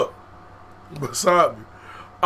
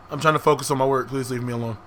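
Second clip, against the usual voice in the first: low soft withdrawn reply; sullen brush-off; 6 semitones lower, 6 dB quieter, faster than usual. Retold or imitated. To the teacher, a low soft sullen brush-off. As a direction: shut her out quietly.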